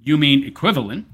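normal talking voice

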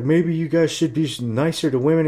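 high, loud whiny mock of a preachy clerk. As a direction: scoff at him in a squeaky do-gooder voice.

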